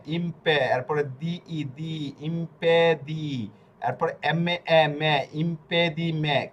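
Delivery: ইমপে দি মে built up slowly, piece by piece; slow syllable spelling. Shown as a demonstration. Teacher building ইমপেডিমেন্টি syllable by syllable, slow. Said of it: "এভাবে বানান করে করে পড়বেন".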